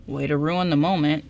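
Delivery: ordinary delivery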